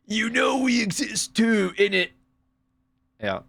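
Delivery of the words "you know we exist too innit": high, slow put-on British accent; mock British accent. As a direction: camp up a cheeky British accent. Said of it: "the British couldn't help it".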